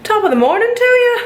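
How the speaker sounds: high, fast, hammed-up Irish lilt; mock Irish greeting. Vlogger jokingly greeting viewers in a mock Irish accent. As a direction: play the cheeky Irishman.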